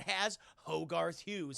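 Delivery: normal talking voice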